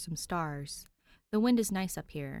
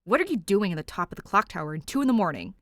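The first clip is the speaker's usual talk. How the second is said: louder, stressed male voice; stressed man; 2 semitones higher, 10 dB louder, faster than usual. A narrator's reading. Stressed captor questioning Danny on the tower. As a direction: demand it, frazzled. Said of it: "sounds beyond stressed".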